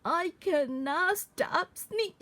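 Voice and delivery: plain reading voice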